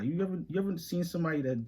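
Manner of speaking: ordinary delivery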